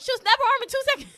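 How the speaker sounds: high, fast, laughing delivery of the commentator's line; laughing quote. Retold or imitated. Retelling the commentator's call, laughing high and loud. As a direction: blurt it out while laughing. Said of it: "even King was like".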